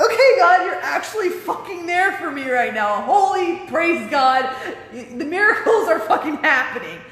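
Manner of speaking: high, loud, elated praise; elated cry of thanks to God; excited praise. Retold or imitated.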